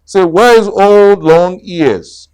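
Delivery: loud jaunty tiger question; hearty question. Acted. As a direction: call it out cheerfully. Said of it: "asked Tiger".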